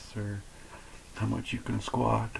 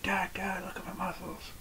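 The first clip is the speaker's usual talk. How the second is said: put-on high, hushed child-like voice; daughters imitated; 10 semitones higher, 8 dB quieter than usual. Daughters calling dad to look at muscles, hushed imitated child voice. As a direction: go high and soft, fading into a whisper. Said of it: "My daughters do that".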